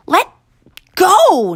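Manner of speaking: high panicked shriek, very loud. Acted. Boy grabbed by guard, shrieking high. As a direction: shriek to get free. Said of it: "Noah shrieked".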